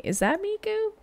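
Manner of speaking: high, soft, rising mock query; playful questioning. Reactor addressing a whispering singer, playfully questioning. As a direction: ask softly, pitch soaring in playful suspicion.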